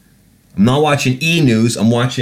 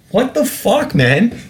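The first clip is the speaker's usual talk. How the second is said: incredulous high-pitched outburst, much higher; outraged; 12 semitones higher than usual. Real, not acted. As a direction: explode in disbelief at the news coverage. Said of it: "shouldn't be so aggressively against it".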